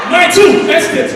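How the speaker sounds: slow, loud drawn-out plea with a laugh; mock beggar cry. Retelling the beggar shouting in the street.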